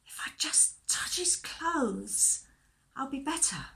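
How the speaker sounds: slow, half-whispered private thought; hushed inner voice, drawn out; thinking to herself. Acted. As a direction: whisper it to yourself with hope. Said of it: "she thought to herself".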